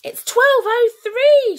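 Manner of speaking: high, loud, slow yell; nervous kid shouting at the beast; frantic shout. A narrator's reading. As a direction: shout nonsense to distract. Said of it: "shouted Starkley's once most nervous kid".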